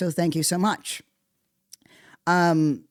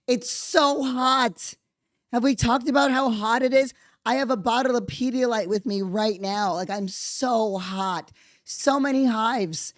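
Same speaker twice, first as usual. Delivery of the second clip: exasperated wail; 6 semitones higher than usual. Real, not acted. Podcaster melts down over summer heat, overwrought whine. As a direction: moan it out like you can't take it. Wailing about heat and freedom.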